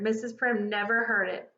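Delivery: ordinary delivery, nothing marked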